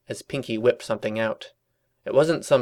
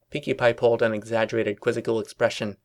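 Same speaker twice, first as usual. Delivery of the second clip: usual speaking voice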